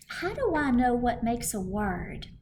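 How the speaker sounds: usual speaking voice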